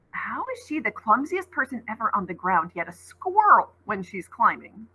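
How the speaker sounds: ordinary delivery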